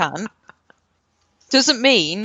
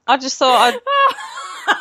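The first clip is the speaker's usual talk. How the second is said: laughing; much slower than usual. Real, not acted. Laughing while excusing herself.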